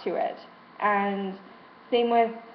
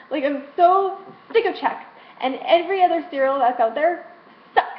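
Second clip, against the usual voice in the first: same words, louder and higher; exasperated louder repeat; louder, higher frustrated whine; 5 semitones higher, 5 dB louder than usual. Real, not acted. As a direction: push the complaint out harder. Repeats the complaint, voice rising.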